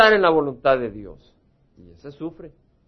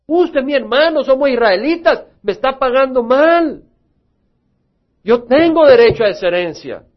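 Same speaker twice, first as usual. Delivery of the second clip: indignant brother; 7 semitones higher, 5 dB louder than usual. Acted. Brother demands inheritance.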